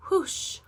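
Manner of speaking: a breathy rushing whoosh like wind; whoosh sound. Shown as a demonstration. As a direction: blow it out like a gust. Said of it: "W H says wh".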